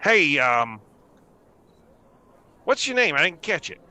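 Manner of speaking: gnome brushing off. Acted. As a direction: shrug her off, then perk up. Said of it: "sort of looks over and goes".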